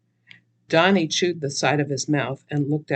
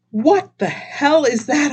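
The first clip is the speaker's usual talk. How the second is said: gasped; 11 semitones higher, 6 dB louder than usual. Acted. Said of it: "I gasped".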